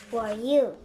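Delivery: loud sneering question; a huge rider sneering at Jack. Acted. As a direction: sneer it down at him. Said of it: "he sneered".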